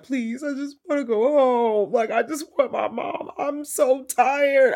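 high, mocking a crying character; whiny victim voice. Imitating a horror character crying for mom, high whiny. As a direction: whimper like a scared kid wanting mom. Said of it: "somebody was like".

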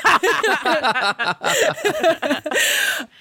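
long high laugh; a real, bubbling laugh. Real, not acted. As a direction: laugh freely at your own joke.

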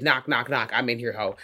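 lightly voiced ghost, quiet and low; mock ghost voice. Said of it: "before the ghost said".

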